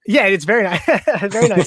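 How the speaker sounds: laughing. Real, not acted. Teasing agreement about girlfriend.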